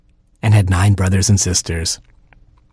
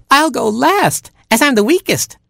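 very high little chick voice; tiny, timid chick; Baby Chick. A narrator's reading. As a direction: squeak it out, drawing out the first words. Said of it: "said Baby Chick".

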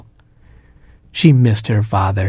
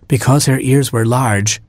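plain reading voice

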